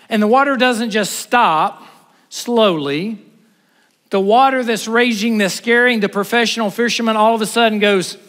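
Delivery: high, loud, building sermon climax; full-force preaching. Acted. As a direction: preach it with rising fire. Preaching at full force, Jesus calms sea.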